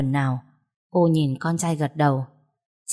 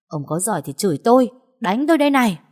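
louder, rising to a high taunting yell; defiant shout; 6 semitones higher, 5 dB louder, faster than usual. Acted. Wife daring her husband to hit her, shouting. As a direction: dare him to hit you. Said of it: "vênh mặt lên thách thức".

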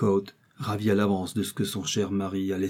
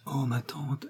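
soft whispered plea; murmured; 4 semitones higher, 8 dB quieter, slower than usual. A narrator's reading. Niece whispering a hopeful plea to her aunt. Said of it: "murmura Sophie Martin".

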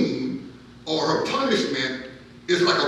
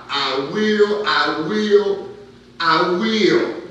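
louder, insistent declaration; deliberate cry; 4 semitones higher, 7 dB louder, much slower than usual. Acted. Preacher quoting the prophet's vow, deliberate cry. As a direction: cry it out with mounting resolve. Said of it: "we see his deliberate cry".

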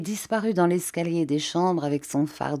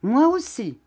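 high eager chime-in; announcing; 7 semitones higher, 3 dB louder than usual. A narrator's reading. Vasse matching the offer, high eager. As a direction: jump in to match him. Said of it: "annonça monsieur Vasse".

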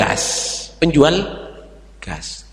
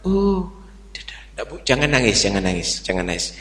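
soothing comfort; 6 semitones lower, 6 dB quieter, faster than usual. Retold or imitated. Saint comforting crying child.

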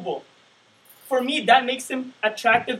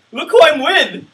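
loud, higher show-off brag; bragging; 5 semitones higher, 10 dB louder than usual. Retold or imitated. Guy bragging about his date, loud showy brag. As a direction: crow it to everyone around, louder. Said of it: "he's trying to brag".

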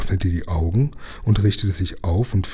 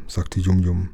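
plain reading voice

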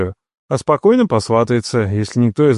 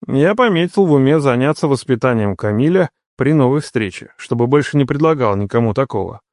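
ordinary delivery, nothing marked

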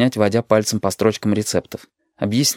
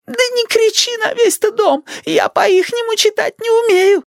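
put-on piercingly high, slow old-woman voice; high granny whine; querulous grandma. A narrator's reading. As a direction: complain in a drawn-out, very high granny voice. Grandmother complains in quarrel.

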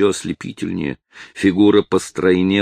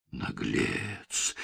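hissed in a whisper, much softer; whispered contempt; 3 semitones higher, 14 dB quieter than usual. Acted. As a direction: spit it out under the breath. Dolores rebukes villain. (презрительный шёпот)

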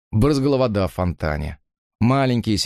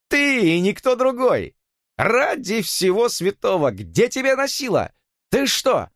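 Jimmy, joyful scolding; 11 semitones higher than usual. A narrator's reading. Jimmy scolds Harvey for disappearing, high and relieved. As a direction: tease him for vanishing.

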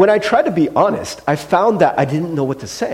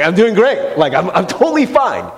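raised, over-bright reassurance; big fake smile; 2 semitones higher than usual. Husband trying to convince his wife, falsely cheery. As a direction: insist through a big forced smile. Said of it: "smile real big".

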